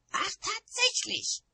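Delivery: high, slow, loud screech; outraged high-pitched witch. A narrator's reading. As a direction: screech it in drawn-out indignation. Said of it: "kreischte Nanny".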